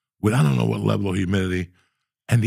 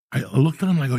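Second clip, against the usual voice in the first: usual speaking voice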